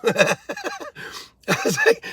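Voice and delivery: high, slow laugh stretching the words; real self-mocking laughter. Real, not acted. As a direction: laugh it out at yourself. Laughs at own slowness.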